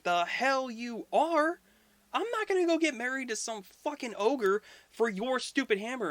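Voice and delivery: mock-furious goddess; outraged Freya. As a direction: refuse it high and outraged.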